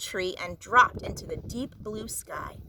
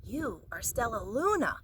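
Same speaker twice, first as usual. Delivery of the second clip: whispered; 6 dB quieter than usual. A narrator's reading. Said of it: "She whispered".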